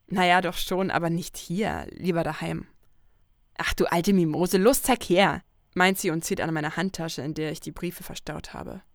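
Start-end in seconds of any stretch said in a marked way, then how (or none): 3.6-5.4 loud playful taunt; teasing Lucy. Acted. Lucy teases her shy friend while tugging at her handbag, loud and playful. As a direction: call her a sissy and grab the bag, laughing.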